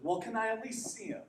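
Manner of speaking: put-on timid boy's request; lightly voiced small boy; little boy asking. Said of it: "and he says".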